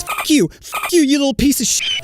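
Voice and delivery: high-pitched Karen screech; imitating an enraged woman; mock furious cursing. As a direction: hurl insults in a hammed-up shriek. Karen curses him before security.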